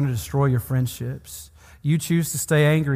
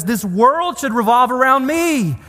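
higher, louder mock self-pity; self-centred whine; 10 semitones higher, 5 dB louder than usual. Preacher mocking a self-centred believer, loud mock whine. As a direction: sneer it like a spoiled brat. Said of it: "the attitude that says".

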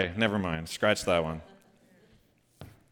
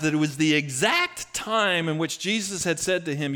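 much higher, louder fired-up preaching; preaching at full force; 7 semitones higher, 5 dB louder than usual. Acted. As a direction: rouse the congregation with the good news.

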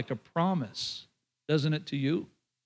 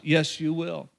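usual speaking voice

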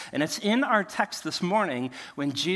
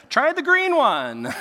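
high, louder, slow heckling call; mock advice-giver; 11 semitones higher, 7 dB louder, slower than usual. Onlooker advising failed fishermen, mock calling out. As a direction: call out fishing tips, pitched way up. Mimics unsolicited fishing advice.

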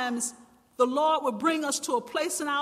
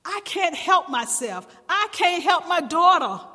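desperate cry; 5 semitones higher, 6 dB louder than usual. Acted. Woman's desperate plea voiced.

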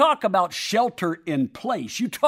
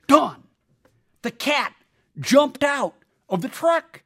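urgent, higher, louder friend; friend breaking bad news; 8 semitones higher, 5 dB louder, slower than usual. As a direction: blurt out the news to Don. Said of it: "and he says".

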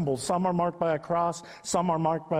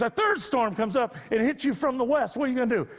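mock challenging instructor; 7 semitones higher than usual. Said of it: "He goes".

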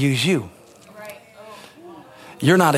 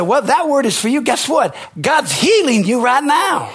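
retold healing proclamation; 6 semitones higher than usual. Pastor tells a sick man God heals him, proclaiming. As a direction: build into a preacher's proclamation, retelling it.

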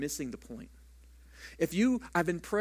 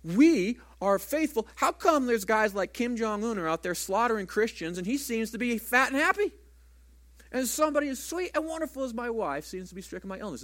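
angry protest at God; 5 semitones higher, 4 dB louder than usual. Retold or imitated. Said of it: "I'm like".

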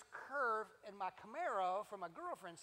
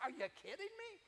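amazed disbelief; 11 semitones higher than usual. Retold or imitated. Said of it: "And I said".

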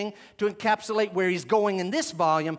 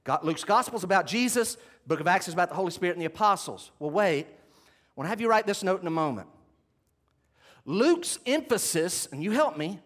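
normal talking voice

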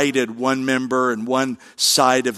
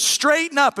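much higher, scolding "Straighten up"; stern teacher command; 12 semitones higher than usual. Retold or imitated. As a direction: bark it like a strict schoolteacher. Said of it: "She goes".

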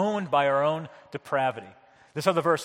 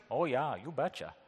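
softer Midwestern callback; understated Midwestern accent; Wisconsin accent; 2 semitones lower, 7 dB quieter than usual. Shown as a demonstration. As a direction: slip back into Wisconsin mother-in-law. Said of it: "how she would say this".

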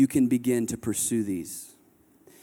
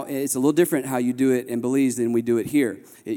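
normal talking voice

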